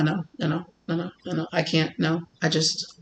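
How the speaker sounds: very soft, murmured string of refusals; anxious backing away. Retold or imitated. Host torn over wanting them, anxiously backing away. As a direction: shrink away into low, repeated murmurs.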